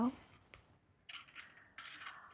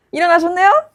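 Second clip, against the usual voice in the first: mock cheerful; 6 semitones higher, 12 dB louder than usual. Killer taunts waking captive.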